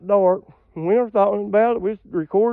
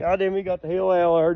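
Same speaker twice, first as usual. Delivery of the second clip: usual speaking voice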